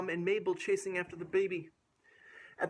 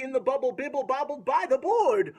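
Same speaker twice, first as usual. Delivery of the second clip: excited; 9 semitones higher, 8 dB louder than usual. A narrator's reading. Climax line read excitedly.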